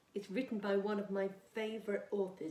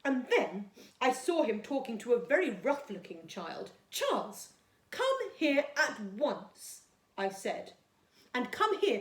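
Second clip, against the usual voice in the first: put-on storytime reading for young children; gentle storybook read-aloud for Year two; reading a story; 2 semitones higher, 5 dB louder than usual. A narrator's reading. Teacher reads story aloud to class.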